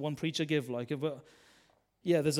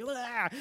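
put-on child's squeal of delight; 9 semitones higher than usual. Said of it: "he's like".

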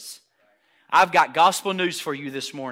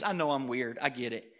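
ordinary talk

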